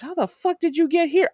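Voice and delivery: a brother shocked to see his sister at the door; surprised yell. Retold or imitated. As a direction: blurt it out in disbelief. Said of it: "And he goes".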